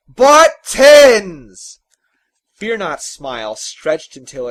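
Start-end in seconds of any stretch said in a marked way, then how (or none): 0.1-1.8 very loud, high bellow; volcanic shout. A narrator's reading. Father repeats the errand to his son in a volcanic bellow. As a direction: erupt like a volcano. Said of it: "his voice volcanic".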